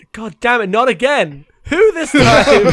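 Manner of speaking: loud, rising comic frustration; mock exasperated outburst. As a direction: build to an incredulous shout. Acts exasperated warlord.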